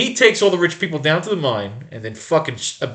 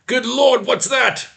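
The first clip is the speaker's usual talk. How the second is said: high panicked voice; 12 semitones higher, slower than usual. Acted. Panicked man in a cave-in.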